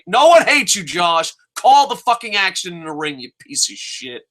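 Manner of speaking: indignant burst, pitch jumping up; exasperated outburst. Real, not acted. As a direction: yell it at the commentator. Yelling back at commentator Josh.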